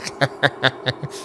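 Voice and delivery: rasping, crunching throat noise; imitated gnawing. Child gnawing a brush of hair, imitated rasping crunch. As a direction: imitate teeth tearing at food. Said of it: "dia gigit macam gigit ayam goreng".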